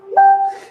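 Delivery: short high laugh; brief high-pitched chuckle; amused laugh. Real, not acted. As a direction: laugh at your own silly accent. Laughs at own accent attempt.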